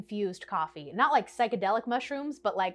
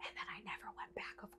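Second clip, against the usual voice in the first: whisper; 21 dB quieter than usual. Real, not acted. Confessing she never went back.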